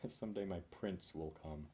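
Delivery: soft, spoken aside after a laugh; quiet spoken repeat. Real, not acted. Comedian repeating the punchline to himself, quietly spoken. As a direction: say it low to yourself, amused.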